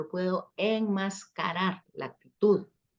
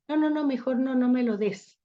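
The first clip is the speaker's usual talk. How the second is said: higher-pitched mock refusal; acted refusal; 6 semitones higher than usual. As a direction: wave it away in a raised voice. Acted refusal answering an attitude.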